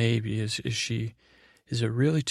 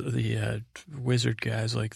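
ordinary talk

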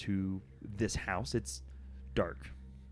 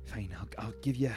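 whispering; 3 dB quieter, faster than usual. Acted. Character pleading, whispered.

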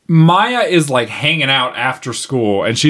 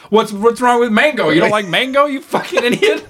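high, exasperated mock dad, breaking into a laugh; 7 semitones higher than usual. Dad scolding daughter over mango, mock-exasperated and high. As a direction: parody the clueless father's outrage. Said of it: "And he's all".

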